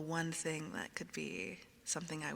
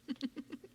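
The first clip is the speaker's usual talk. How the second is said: short, high little giggle; 8 semitones higher than usual. Real, not acted. Streamer thanking viewer for gifted subs, giggling. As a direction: let a small giggle slip out. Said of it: "You like the A S M R giggles?".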